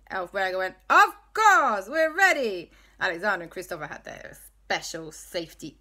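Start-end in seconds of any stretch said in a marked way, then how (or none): 0.9-2.7 put-on Italian accent. El Fuego voiced.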